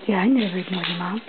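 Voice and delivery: low put-on doggy voice; voicing the dog. Speaks as dog answering its owner.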